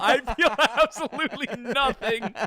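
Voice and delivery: real laughter breaking through the words; high, helpless laugh while insisting on it. Real, not acted. Cracking up while answering the question.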